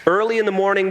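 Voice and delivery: ordinary talk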